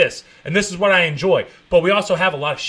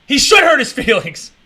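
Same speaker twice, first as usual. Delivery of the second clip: loud, high emphatic declaration; loud and insistent; loud insistence; 6 semitones higher, 6 dB louder than usual. Real, not acted. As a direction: hammer the point home loudly. Repeated emphatic rant.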